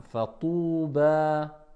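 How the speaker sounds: ordinary delivery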